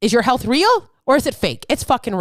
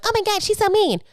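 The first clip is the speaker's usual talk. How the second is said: high-pitched mock whine; mock wounded complaint; offended listener; 11 semitones higher than usual. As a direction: imitate a hurt listener, pitched way up. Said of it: "if this hurts your feelings".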